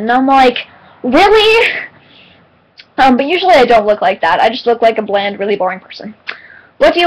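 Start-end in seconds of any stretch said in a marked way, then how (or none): 1.0-1.9 very high-pitched, incredulous question; mock flattered surprise. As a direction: squeak it upward in acted disbelief. Acted reply to flattering compliment.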